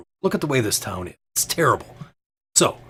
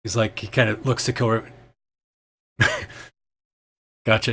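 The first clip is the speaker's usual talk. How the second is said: usual speaking voice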